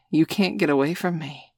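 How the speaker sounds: ordinary delivery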